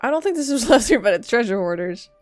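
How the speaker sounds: ordinary talk, nothing marked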